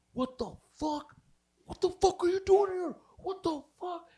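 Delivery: high, loud put-on screech of outrage; hammed-up jealous boyfriend shouting; mock furious yell. Boyfriend shocked and angry.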